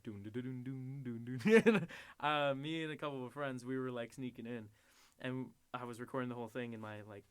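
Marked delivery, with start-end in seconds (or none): 0.0-1.9 sung-out doons jumping up at the end; imitated phone chime. Phone starting to record in the pack, imitated chime. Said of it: "it was like".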